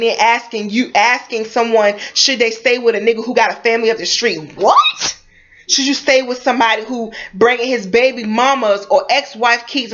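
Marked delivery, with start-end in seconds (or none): 4.6-5.2 shrill squeaked "What?" shooting far up in pitch; incredulous what. Real, not acted. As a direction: screech the word in utter disbelief. Outraged at letter-writers' question.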